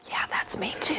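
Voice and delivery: soft confiding whisper; whispered aside. Real, not acted. Pastor confides to the kids in a whisper.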